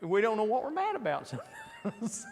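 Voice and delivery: breaking into laughter mid-thought; laughing. Real, not acted. Preacher jokes with the class, laughing.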